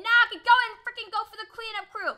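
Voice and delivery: high, loud rush of words; excited yelling. Real, not acted. Gamer going for the cleanup crew, frantic yell. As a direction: holler it at the top of your voice. Excited as chance for kills arrives.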